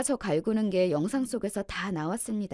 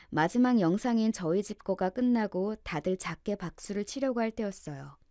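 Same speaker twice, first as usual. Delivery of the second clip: usual speaking voice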